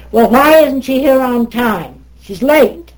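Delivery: loud, high-pitched mock indignation; imitating scolding teacher. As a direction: demand it like an affronted teacher. Teacher scolds the late president.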